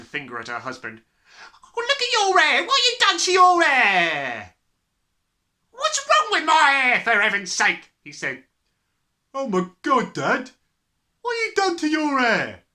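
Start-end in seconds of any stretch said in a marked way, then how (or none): 1.6-4.5 high, very loud shrieking mother. A narrator's reading. Mother pointing at her husband's hair, shrieking. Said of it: "the mother was shrieking". 5.7-7.9 high, loud protest; loud indignation. A narrator's reading. Father defends his hair to his wife, indignant. 9.3-12.6 high, loud shouting son. A narrator's reading. Son sees his dad's hair, shouting. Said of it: "the son shouted".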